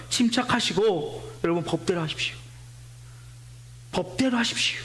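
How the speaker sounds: low, level, composed appeal; calm and cool. Retold or imitated. Town clerk calmly tells the angry crowd to follow the law. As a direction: soothe the mob with cool authority. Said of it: "냉정하게 얘기하는 거예요".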